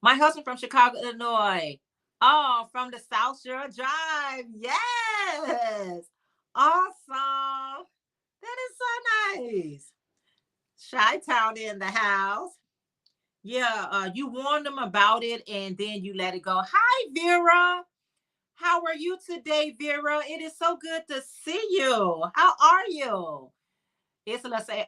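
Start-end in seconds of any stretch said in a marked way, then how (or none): 2.2-9.9 high, drawn-out excited reaction; delighted cheer. Real, not acted. Delighted reaction to viewer's hometown. 16.7-23.5 delighted greeting. Real, not acted. Greets a viewer by name.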